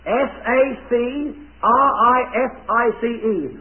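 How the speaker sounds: slow spelling. Shown as a demonstration. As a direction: spell it out slowly, letter by letter. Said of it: "spelling the word slowly".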